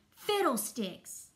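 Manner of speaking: loud, drawn-out exclamation; roaring. A narrator's reading. Conductor interrupts little Piccolo, roaring. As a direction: cut in with a slow, storybook roar. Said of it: "roared Mister Baton".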